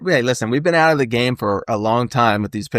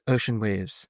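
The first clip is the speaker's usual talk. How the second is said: calm zen murmur; 7 dB quieter, slower than usual. Retold or imitated. A friend quoting a calm sensei, hushed and zen. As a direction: breathe it out like a zen master. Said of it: "he goes".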